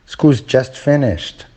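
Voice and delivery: "School's just finished" stated slowly, pitch falling at the end; falling statement tone. Shown as a demonstration. Teacher demonstrating a statement of fact to learners, "School's just finished" with falling intonation. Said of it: "Statement of fact. ... follows a falling tone".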